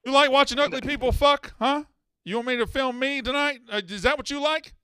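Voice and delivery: high, loud taunting tone; mocking tease. Host mocking caller's taste.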